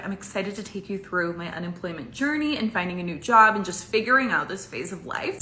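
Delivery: ordinary delivery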